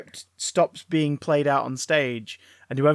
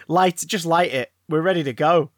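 ordinary delivery

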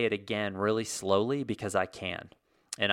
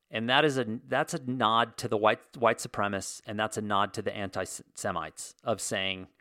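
usual speaking voice

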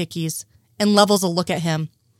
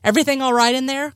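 higher, quick call; 6 semitones higher, faster than usual. A narrator's reading. Frohike checks on them from the hall, calling out. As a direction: call in briskly from outside. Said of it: "calls out Frohike".

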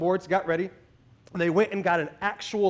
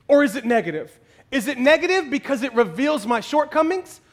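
ordinary talk, nothing marked

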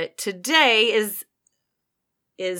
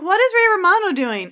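surprised; 11 semitones higher, 6 dB louder than usual. Retold or imitated. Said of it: "'cause I was like".

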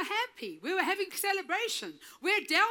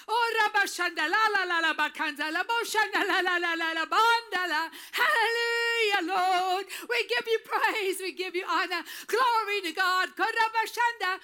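praying in tongues; 2 semitones higher, 3 dB louder than usual. Real, not acted.

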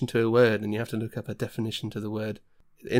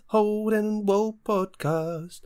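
announcer voice; 8 semitones higher, slower than usual. Pretend. Podcast intro in put-on voice.

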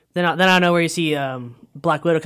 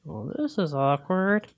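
dry awkward remark; much slower than usual. Retold or imitated. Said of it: "Falcon goes".

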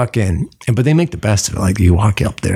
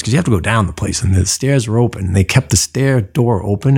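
ordinary talk

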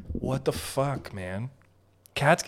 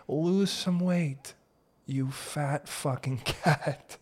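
slow, drawn-out mock scolding; 3 semitones higher, much slower than usual. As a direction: stretch out each word at the cat. Mock scolding of a fat cat.

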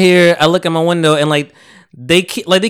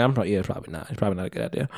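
quietly backing off the idea; hushed caution; 8 semitones lower, 13 dB quieter, faster than usual. Retold or imitated. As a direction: drop it to a soft, hesitant hedge. Friend's cautious reply retold.

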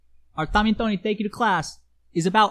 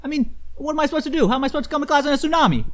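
loud, fast fake panic; hammed-up flustered excuse; mock panic; 3 semitones higher, 6 dB louder than usual. Student faking panic excuse.

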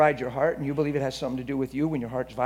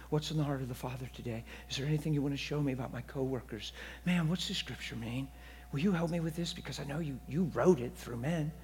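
intimate prayer; 8 dB quieter than usual. Retold or imitated. Believer asking the Holy Spirit about coworkers, soft and intimate. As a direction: ask gently, as to a close friend. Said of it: "I just had that with him all the time".

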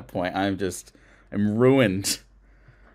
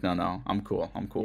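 softly insisting; 5 semitones lower, 10 dB quieter than usual. Retold or imitated. Holding back tears.

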